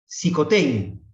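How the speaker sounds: loud, high proclamation falling at the end; palabra de Jesús. Acted. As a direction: proclaim it as a solemn command. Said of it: "Jesús solamente dice una palabra". (palabra proclamada con fuerza)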